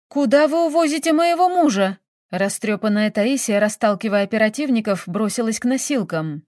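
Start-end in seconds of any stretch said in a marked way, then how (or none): 0.1-2.0 high-pitched, distressed cry; distraught protest. A narrator's reading. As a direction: cry out to stop them. Widow rushes at the stretcher. (отчаянный крик протеста)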